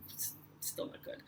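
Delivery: unvoiced whispered repeat; whispered aside. Real, not acted. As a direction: breathe it as a rueful aside.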